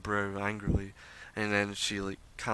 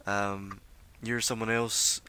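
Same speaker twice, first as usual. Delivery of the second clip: ordinary talk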